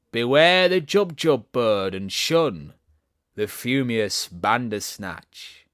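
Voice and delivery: loud, slow ominous reading; dramatic poem recital. Acted. Alice reading Jabberwocky, slow ominous declamation. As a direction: intone it like a dark warning.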